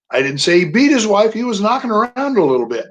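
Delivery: joking twist, lean on beat; stress on BEAT. Shown as a demonstration. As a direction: BEAT punched high and loud. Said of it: "where you put the inflection".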